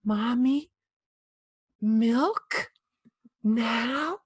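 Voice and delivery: mock airy little-kid request; airy toddler voice. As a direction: coo it like a toddler copying mom. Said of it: "I speak airy".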